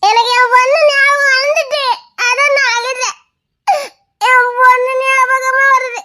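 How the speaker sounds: ghost grandmother sobbing over her lost daughter; crying. Acted. Old woman crying to the lost travellers about her daughter, sobbing. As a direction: wail it through tears, pitch climbing. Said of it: "ஏன் பாட்டி அழுவுதே?".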